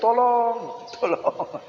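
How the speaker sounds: high, fading yelp of tolong; mock cry for help. Child in class crying tolong, mock plea dying away. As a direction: play a child crying out, then trailing to breath. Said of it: "Jangan berteriak-teriak".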